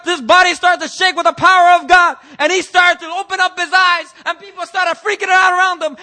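high, loud, shouted preaching; preaching at full force. Real, not acted. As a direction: exult as the dead rise. Preaching climax.

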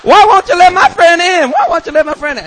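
put-on loud, high drunken protest; hammed-up high-pitched hollering; drunk friend yelling. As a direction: yell it like an indignant drunk. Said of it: "And she's like".

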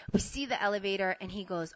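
ordinary delivery, nothing marked

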